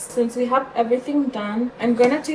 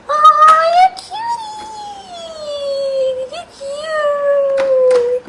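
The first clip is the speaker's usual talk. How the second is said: very high, squealed cooing; gushing pet talk; 3 dB louder, much slower than usual. Real, not acted. Owner gushing over her cute dog, high squealed cooing. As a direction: squeal adoringly at a pet, pitched way up.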